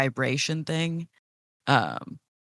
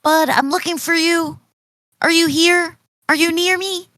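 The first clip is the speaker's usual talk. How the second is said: high-pitched, loud calling voice; Leah in plant speech; 12 semitones higher, 5 dB louder than usual. Acted. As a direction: call out high to a lost friend. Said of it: "in plant speech, she says".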